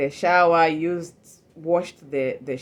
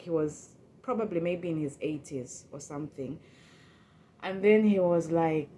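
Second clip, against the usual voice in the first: ordinary delivery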